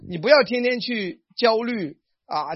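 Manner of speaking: ordinary talk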